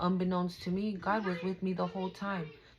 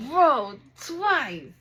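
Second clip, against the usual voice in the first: much higher and louder, an amazed outburst; excited shout; 7 semitones higher, 10 dB louder than usual. Real, not acted. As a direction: burst out in disbelief at the repeat. Card came out twice again.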